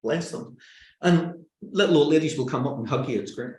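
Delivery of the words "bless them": slow, soft, higher cooing pity; sympathetic pity. Acted. Audience feeling for the speaker up there, softly pitying. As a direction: coo with sympathy, unhurried. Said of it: "they're feeling sympathy for you".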